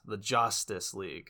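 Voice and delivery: "Justice" pronounced "JOSS-tice"; emphasis on Joss. Shown as a demonstration. Pointing out the pun to the co-host, "Justice" said as JOSS-tice. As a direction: lean on the first syllable of "Justice", making it Joss. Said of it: "Note the emphasis on Joss".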